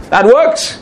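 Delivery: rising, upbeat that works; bright rising approval; approving affirmation. Real, not acted. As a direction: say works with a lifted, approving rise.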